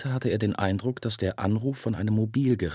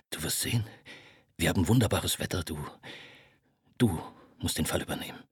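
low murmur, half whispered, rushing at the end; an exhausted man begging for help; low, drunk and pleading; 4 semitones lower, 4 dB quieter than usual. Acted. Ferris pleads with Marthaler to come, low and drunk.

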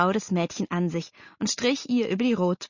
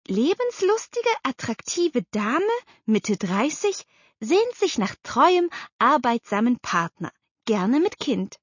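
reading an ad; 5 semitones higher than usual. A narrator's reading. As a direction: read the personal ad out invitingly. Aunt reads out personal ad. (betont vorgelesene Heiratsanzeige)

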